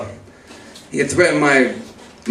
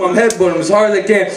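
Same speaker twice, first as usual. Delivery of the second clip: ordinary delivery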